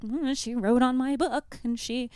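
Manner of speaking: a hint of a prim old lady complaining; fussy librarian. Mom mimicking the librarian's complaint, slow mock prim voice.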